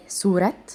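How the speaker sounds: سورة opened with a light plain s; sad as s. Shown as a demonstration. Teacher giving an example, سورة with sad said as seen. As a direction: say سورة as "surat", no heavy sad. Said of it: "الصاد في اللغة الفارسية بتنطق سين".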